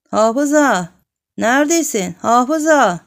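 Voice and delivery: loud call from the shop, high and loud. A narrator's reading. Shopkeepers calling the hafiz from the shop, loud call. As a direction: shout the name across the yard. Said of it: "diye seslendiler".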